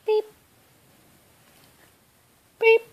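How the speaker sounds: ordinary talk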